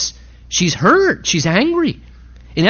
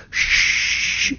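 mock shush; 4 dB quieter than usual. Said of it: "little hospital representative".

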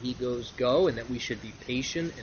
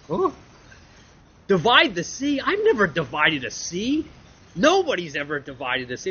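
disbelief; 3 semitones higher, 5 dB louder than usual. Acted. Moses stunned by God's command.

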